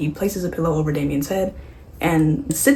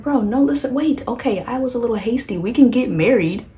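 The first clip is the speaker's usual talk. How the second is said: higher, rushed pleading Chucky; panicked backpedaling; 6 semitones higher than usual. Retold or imitated. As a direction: beg fast to be let out. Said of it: "Chucky's like".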